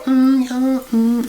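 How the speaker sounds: high, hammed-up phone-sex hum; playful fake moan to a caller; mock phone-sex moan. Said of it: "I'll sit there and go".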